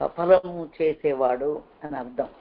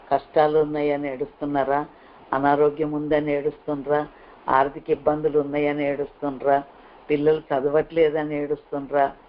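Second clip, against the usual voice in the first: ordinary talk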